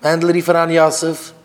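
loud summons to a listener; calling out. Real, not acted. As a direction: call across to someone by name. Calls to people in the room.

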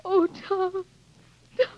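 tearful, broken high cry of his name. Acted. To Tom as war begins, crying. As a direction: let the tears break through.

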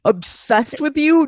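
high, slow disbelief; incredulous. Real, not acted. Host repeats a reality star's gushing line in slow, high disbelief. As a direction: stretch it out, appalled. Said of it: "mocking Paige's words in disbelief".

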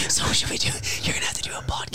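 urgent whisper; whisper yelling. Pretend. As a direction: hiss it like a shouted secret. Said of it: "Why are we whispering?".

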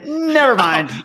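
high, drawn-out mock retraction; put-on squeal of second thoughts; comic last-second retraction. Voices lawyer backing out last second.

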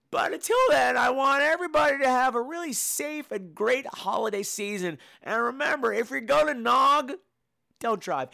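high, projected send-off; big high-voiced outro; high-pitched holiday sign-off. Real, not acted. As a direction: call it out high to all the listeners. Showy holiday sign-off to listeners.

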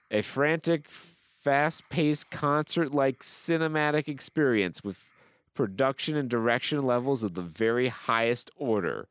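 high, declaimed enthusiasm; gushing review read-out. Acted. Host reading a rave review, hammed-up gushing. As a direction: proclaim it like a superfan.

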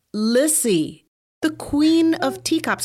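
grand introduction. Acted. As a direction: present her like a showstopper. Host introduces guest grandly.

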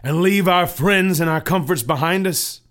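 loud, scornful neighbor; scoffing neighbor Obstinate. Acted. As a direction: scoff at leaving comforts behind.

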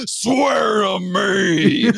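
slow, loud shouted Batman rasp; Batman growl. Retold or imitated.

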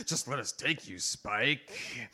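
soft, low sultry purr; low seductive voice. Acted. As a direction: lure him in softly. Seductive woman.

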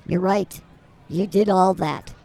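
ordinary talk

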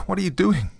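high, panicked cry; frightened cry. A narrator's reading. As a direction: cry it out in alarm. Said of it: "she cried".

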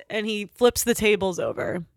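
normal talking voice

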